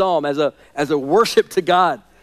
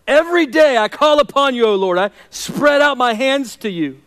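preaching a despairing prayer, higher and louder; anguished prayer; 6 semitones higher, 5 dB louder than usual. Acted. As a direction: cry out to God as a man who feels dead. Reads psalm with rising passion.

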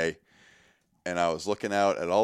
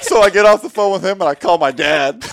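loud, high retelling through laughter; high, laugh-filled storytelling; 13 semitones higher, 13 dB louder than usual. Real, not acted. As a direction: keep telling it while cracking up.

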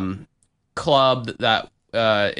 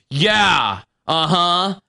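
mock drunk insistence, slightly raised voice; Kimmy; 5 semitones higher, much slower than usual. Drunk woman bluffing that she understood German, mocking imitation. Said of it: "she just goes".

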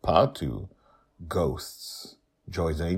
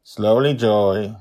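a mild, raised warning; 5 semitones higher, 8 dB louder, slower than usual. Acted. Justin warning Joy at the helm, a mild raised caution. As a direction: caution her lightly from the deck. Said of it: "Justin warns".

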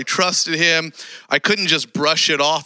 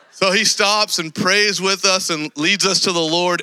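usual speaking voice

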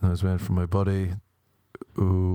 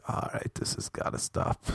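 whispered, much softer aside; 3 semitones lower, 8 dB quieter than usual. Retold or imitated. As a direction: hush it like a private thought. Retold private thought.

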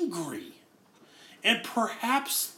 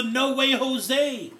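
no way, Jose said slow, higher and louder; emphatic no way; 5 semitones higher, 5 dB louder, much slower than usual. Shown as a demonstration. Preacher showing the emphatic Greek never, slow and punchy. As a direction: stretch out no way, Jose. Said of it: "It's the".

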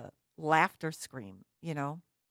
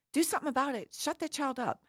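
much higher, louder imitation of a scolding onlooker; mock indignant demand; 10 semitones higher, 4 dB louder, faster than usual. Onlookers scolding parents of a screaming child, mock indignant. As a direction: imitate an outraged bystander, high-pitched.

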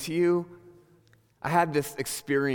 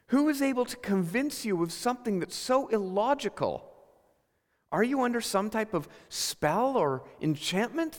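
high-pitched, incredulous acted scolding; red-faced exasperation; 7 semitones higher than usual. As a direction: demand an explanation as if utterly baffled by them. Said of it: "red in the face going".

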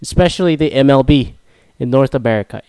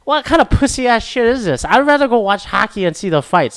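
higher, puffed-up mock macho voice; mock macho tough guy; 8 semitones higher than usual. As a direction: play a scoffing tough guy. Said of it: "I'm like".

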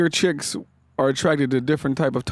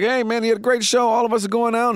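higher, upbeat party-promoter invite; eager promoter; 7 semitones higher than usual. Retold or imitated. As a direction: pump up the comic to come out. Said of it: "the promoter's like".

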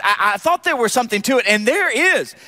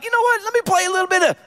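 higher-pitched, quick casual quote; imitated athlete; 9 semitones higher, much faster than usual. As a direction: lightly voice a player deciding on a whim. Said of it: "he's like".

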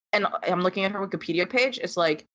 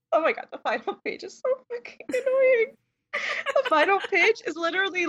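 exasperated outburst; 14 semitones higher than usual. Real, not acted. Exasperated rant about the ending.

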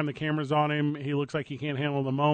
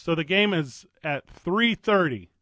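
slow and deliberate; slower than usual. Shown as a demonstration. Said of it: "a lot of information really fast".

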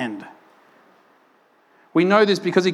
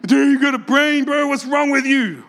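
bro-style scolding; 5 semitones higher than usual. Retold or imitated. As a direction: scold a buddy like a dude-bro. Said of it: "He's talking like that".

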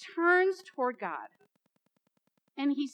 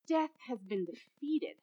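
ordinary talk

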